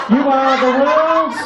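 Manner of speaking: ordinary delivery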